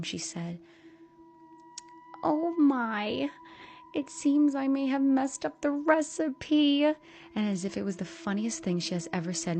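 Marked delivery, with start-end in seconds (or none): 2.2-7.5 higher, lightly voiced sweet mother; breezy fake apology; soft motherly tone. A narrator's reading. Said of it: "with a soft motherly tone".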